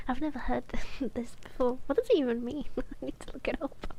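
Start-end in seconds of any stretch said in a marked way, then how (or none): none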